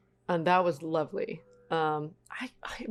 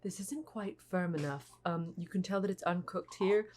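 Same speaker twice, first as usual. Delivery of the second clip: much softer, gentle but crushing critique; soft imitation of a baking judge; judge's criticism; 7 dB quieter than usual. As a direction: quietly deliver a baking judge's verdict. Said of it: "the criticism of, like".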